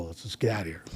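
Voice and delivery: hushed, let's just go; whispered plea. Retold or imitated. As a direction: whisper it, urging him to leave. Friend urging him to leave.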